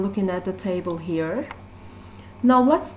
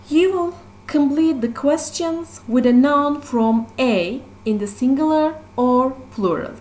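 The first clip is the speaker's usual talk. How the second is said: ordinary talk, nothing marked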